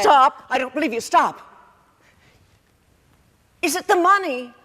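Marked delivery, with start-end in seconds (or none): 0.0-1.4 loud, sharp "Stop!"; distressed outcry. Acted. Mother cutting off daughter's boast about bed, loud and distressed. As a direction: cut in forcefully to silence the other.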